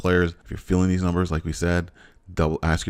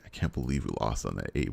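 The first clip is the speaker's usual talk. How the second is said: dejected; 6 semitones lower, 7 dB quieter than usual. Real, not acted. Groaning over a lost lottery.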